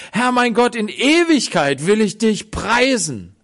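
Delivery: loud, high, full-voiced preaching. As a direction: proclaim it to the whole church. Preaches psalm at full force.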